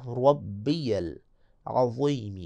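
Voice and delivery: العظیم with a thick emphatic ظ, very slow; slow tajweed demo, heavy ظ. Shown as a demonstration. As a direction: say ربی العظیم slowly, ظ heavy. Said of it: "یہ جو وا ہے ... موٹا پڑھنا ہے".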